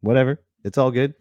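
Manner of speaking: normal talking voice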